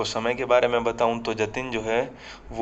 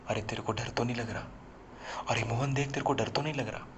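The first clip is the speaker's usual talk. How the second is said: hushed teasing; 8 dB quieter, faster than usual. Acted. Friend needling him during horror film.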